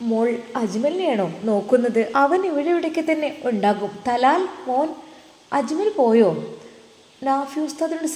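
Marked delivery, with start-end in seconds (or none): 0.1-4.9 put-on old mother's voice. Old mother asking after Ajmal. 5.6-6.3 put-on old mother calling. Mother calls to Talal.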